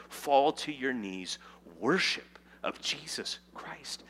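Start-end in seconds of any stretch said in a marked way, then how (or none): 1.8-4.0 slow reverent whisper. Real, not acted. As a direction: hush it like a prayer. Whispered call to worship.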